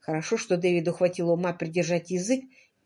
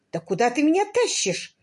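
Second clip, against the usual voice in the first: higher-pitched, mildly loud protest; loud questioning; 8 semitones higher, 4 dB louder than usual. A narrator's reading. Husband being tugged to the back door, mildly loud question. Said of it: "которые громко спрашивают у жены".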